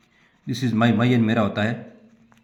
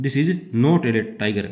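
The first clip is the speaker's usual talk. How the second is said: slow dictation; much slower than usual. Shown as a demonstration. Teacher dictates the example sentence.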